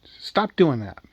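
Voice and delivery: a half-hearted storytelling yell; mild yell. Acted. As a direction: scold the kid with a small yell. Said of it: "She yells".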